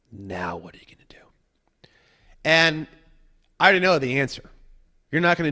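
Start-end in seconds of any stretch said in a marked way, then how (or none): 0.1-1.9 a hushed, pointed question; whisper. Retold or imitated. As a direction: whisper it like a trap closing.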